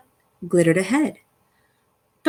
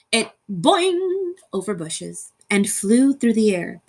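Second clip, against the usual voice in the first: plain reading voice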